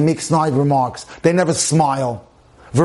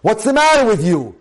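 higher-pitched mock-annoyed husband; exasperated, raised-pitch accusing question; angry demand; 6 semitones higher than usual. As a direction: act out an irritated husband making a complaint. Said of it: "begin their request for help in an angry fashion".

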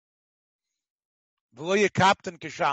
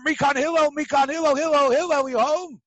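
loud, higher repeated yell for Hillel; calling out; 7 semitones higher, 5 dB louder than usual. Retold or imitated. Man calling Hillel out again, shouted loud and high. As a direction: holler up to the house.

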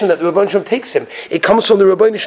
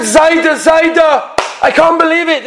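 high-pitched child's wail, acted; imitated tearful grandchild, pitched way up; crying child; 11 semitones higher, slower than usual. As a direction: cry out to grandpa like an upset child. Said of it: "one of his grandchildren came in crying".

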